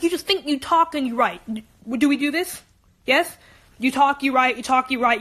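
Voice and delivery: usual speaking voice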